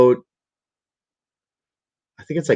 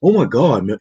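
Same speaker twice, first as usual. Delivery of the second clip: complaining; 3 dB louder than usual. Retold or imitated. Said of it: "My friend Justin's bitching".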